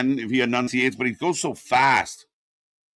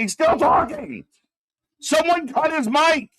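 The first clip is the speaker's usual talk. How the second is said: exasperated call-out; 11 semitones higher, 3 dB louder than usual. Real, not acted. Exasperated calling out.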